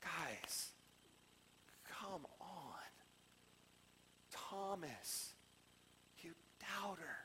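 very soft, half-whispered reproach; low-volume, drawn-out disappointment; hushed chiding. Acted. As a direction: chide them gently under the breath. Jesus chiding disciples, voice dropped.

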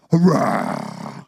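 low, rasping imitated roar; long growled roar; lion roar. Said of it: "a young lion meets you".

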